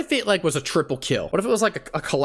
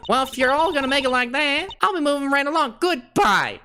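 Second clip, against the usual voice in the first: southern twang; 12 semitones higher, 3 dB louder than usual. Acted. Streamer voicing Tabby with a Southern twang. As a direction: play her as a Southern belle. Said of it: "Should I give her a little southern twang?".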